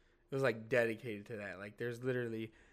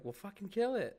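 higher, dismissive mimic of girlfriend; imitating girlfriend; 7 semitones higher than usual. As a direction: brush it off like she would. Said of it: "she'd be like".

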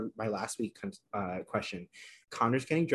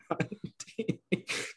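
soundless laugh; 7 semitones higher, 7 dB quieter than usual. Real, not acted. Reacting to a Twitter joke.

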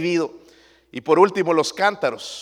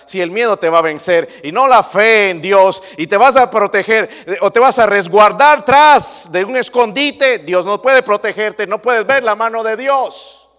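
fervent preaching; 6 semitones higher, 12 dB louder than usual. Acted. Preaching at full force.

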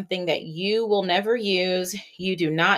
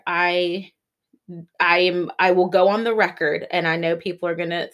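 ordinary delivery

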